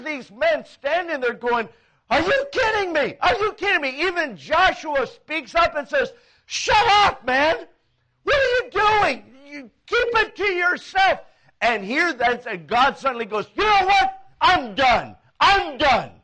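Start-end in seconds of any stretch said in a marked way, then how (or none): 2.1-4.0 high-pitched, incredulous protest; worked-up, higher-pitched exasperation; indignant outburst. Acted. Men acting outraged at idea. 6.5-11.2 loud, high-pitched scolding; yelled telling-off; heated rebuke. Acted. Acts Joshua's angry rebuke. 13.5-16.1 loud, exasperated declaration; punchy, high-starting final verdict; fed-up outburst. Acted. Acts God losing patience.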